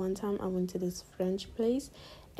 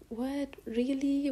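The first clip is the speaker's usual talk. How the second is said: higher-pitched, surprised questioning; mild retold surprise; 6 semitones higher, slower than usual. Diner told her check is cleared, acted surprise. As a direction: act pleasantly taken aback, pitch lifted.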